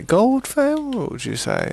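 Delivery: exaggerated mock trader; hammed-up haggling. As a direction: stretch it out like a pantomime merchant.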